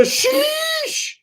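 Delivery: high, drawn-out "sheesh"; requested sheesh. Shown as a demonstration. Streamer obliging a viewer's request, high drawn-out sheesh. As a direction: stretch out a high-pitched hissing sheesh. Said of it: "I hope I did it right".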